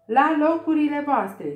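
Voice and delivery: queen ordering everyone to places, thundering; thunderous shout. Acted. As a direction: bellow the order. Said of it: "strigă regina cu un tunet".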